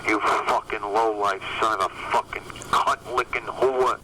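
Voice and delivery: ordinary talk, nothing marked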